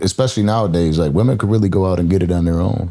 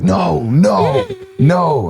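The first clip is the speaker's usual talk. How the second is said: mock panic; 10 semitones higher, much slower than usual. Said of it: "I was like".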